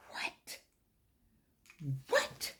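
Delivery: stunned, whispered disbelief; hushed amazement. Real, not acted. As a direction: whisper it in awe, barely any voice. Awed by what she heard.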